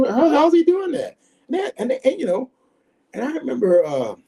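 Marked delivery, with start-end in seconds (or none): 0.1-1.2 mock disbelief. Imitating doubters, incredulous.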